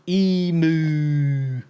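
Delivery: emu drawn out slowly as EEE-mooo; teasing, slow EE-moo for emu; mocked pronunciation. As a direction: stretch emu into a long, mocking EE-moo. Mocking the amusing pronunciation.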